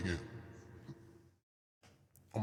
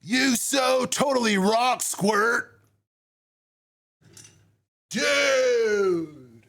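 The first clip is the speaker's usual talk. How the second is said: put-on surfer kid voice; 7 semitones higher, 4 dB louder, slower than usual.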